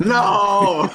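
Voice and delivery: high, drawn-out "no"; long wail of refusal; dismayed cry. Real, not acted. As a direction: cry out in playful protest. Dismayed cry at trivia answer coming.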